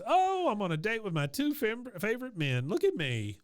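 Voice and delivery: high, gushing show-off mother; retold Peggy. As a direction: brag in a high mock-delighted voice. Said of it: "Peggy says".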